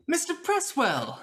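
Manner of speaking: high, drawn-out grand greeting; effusive host. Acted. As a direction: hail the guest as a showy host. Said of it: "I'm the host".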